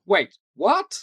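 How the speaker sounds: high, loud startled double take; mock surprise. Two accused characters confronting each other, high startled. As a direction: act out a shocked double take. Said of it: "and they both go".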